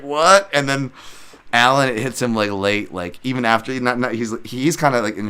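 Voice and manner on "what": high, loud disbelieving yelp; stunned "What?". Retold or imitated. Crowd hearing the split decision, shocked "What?". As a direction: react like the whole crowd can't believe it. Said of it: "everybody's like".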